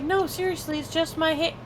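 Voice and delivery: high, exasperated insisting; weary explanation. Retold or imitated. Telling coworkers it's just hiccups, exasperated and high. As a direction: plead it's only hiccups. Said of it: "I'm like".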